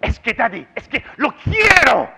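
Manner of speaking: high, loud demand, last word drawn out; insistent little daughter. Retold or imitated. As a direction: imitate a child insisting to daddy she wants it. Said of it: "when she wants something, she says".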